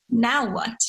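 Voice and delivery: ordinary delivery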